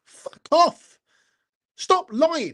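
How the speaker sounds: real fury bursting out; angry outburst. Real, not acted. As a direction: shout them down, fed up. Angry fan ranting at critics.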